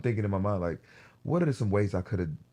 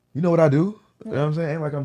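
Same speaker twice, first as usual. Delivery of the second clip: heated outburst; 9 semitones higher, 10 dB louder, faster than usual. Real, not acted. Heated rant about girlfriend.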